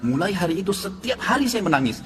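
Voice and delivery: high, heartfelt retelling, as if overcome with gratitude; deeply moved. Retold or imitated. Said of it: "Saya merasa terharu".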